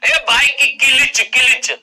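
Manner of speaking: slow, loud village drawl on किलिच; rustic hometown accent. Retold or imitated. Teacher imitates his hometown friends talking about the bike, slow loud rustic accent. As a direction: say किलिच as ki-lich, slow and loud like a local lad. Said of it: "bike का क्लच नहीं होता, किलिच होता है".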